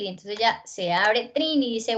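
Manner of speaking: ordinary talk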